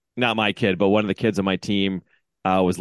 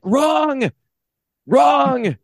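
high, outraged holler of contradiction; re-enacted high-pitched protest; yelling at umpire; 14 semitones higher, 3 dB louder, much slower than usual. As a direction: shout it across the field as a furious coach. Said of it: "I was still yelling at this umpire".

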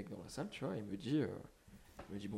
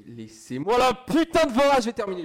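yelling into the mic; 11 semitones higher, 15 dB louder than usual. Real, not acted. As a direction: bellow it so the mic clips. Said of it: "Je viens de hurler dans le micro".

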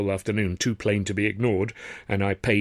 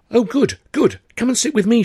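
put-on higher, brighter young woman's voice, urging; eager Tilly; 14 semitones higher, 9 dB louder than usual. A narrator's reading. Tilly beckoning Arthur to her table, eager and high. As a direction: pull him over to the table eagerly. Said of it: "she said, beckoning me urgently".

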